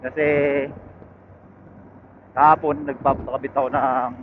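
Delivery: ordinary talk, nothing marked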